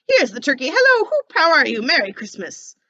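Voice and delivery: loud high joyful hail; Scrooge hailing. Acted. Scrooge greeting the poulterer's man with the turkey. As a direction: greet the man like an old friend.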